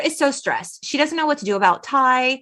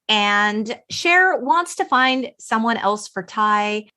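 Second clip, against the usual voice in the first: ordinary delivery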